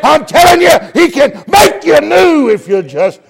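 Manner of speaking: loud, high, shouted preaching that builds to the end; preaching at full force. Acted. Preacher telling the lost that Jesus can save them, full shout. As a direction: thunder it out as a call to the lost.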